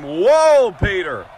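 ordinary talk, nothing marked

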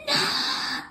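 loud, harsh cry of dismay; anguished shout. Real, not acted. Gamer who misses being with Guffey, anguished shout. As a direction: cry out in protest. Upset shout at game loss.

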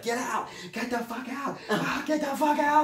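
high, shrieking mock mom yelling get out; mother shrieking at her son to get out, high mock yell. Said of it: "My mom's yelling at me".

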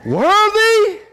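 congregation-rousing call; loud proclamation. Acted. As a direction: belt out the word high and long. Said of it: "With a loud voice, they sang this".

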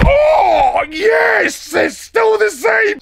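loud, high, thrilled cry; excited shout. Real, not acted. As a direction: whoop with delight at the bounce. Bouncing on old bed.

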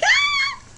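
very high, loud squeal; excited scream. Acted. Vlogger showing viewers the snow excitement, high squeal. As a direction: let out a shriek of pure excitement. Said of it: "I'm just so excited".

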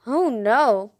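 ordinary delivery

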